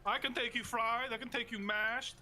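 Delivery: put-on wrestler's Canadian accent. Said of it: "That's a good accent".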